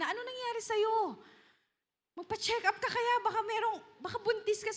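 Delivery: a fussing husband half-teasing; concerned husband. Acted. Husband Elkanah asks his dizzy wife if she's pregnant, worried. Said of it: "Sabi nung kanyang asawang si Elkanah".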